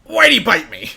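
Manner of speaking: parroting the line in a strange, loud way; weird trucker imitation. Friend imitating the bitten trucker, weird loud delivery. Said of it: "He just says it in the weirdest way possible".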